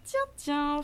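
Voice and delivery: deux mots plus aigus et appuyés; petit garçon intrigué. A narrator's reading. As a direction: relever la chose en garçon qui vient de comprendre. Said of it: "fit Valentin". (garçon intrigué, voix haute)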